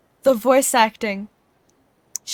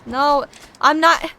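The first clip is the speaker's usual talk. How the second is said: louder, higher, annoyed outburst; real exasperated protest; 4 semitones higher, 3 dB louder than usual. Real, not acted. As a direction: protest loudly at being interrupted. Annoyed at game interrupting sprint.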